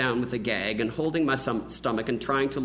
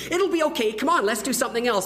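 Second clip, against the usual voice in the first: put-on high, fast pleading boy; pleading kid; 9 semitones higher, 4 dB louder, faster than usual. Boy begging his friend, fast high pleading. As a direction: hurry to talk him out of it.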